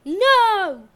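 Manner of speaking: loud, high, drawn-out cry; shouted refusal. A narrator's reading. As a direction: cry out to stop him, at full volume. Wife refuses to hand over child.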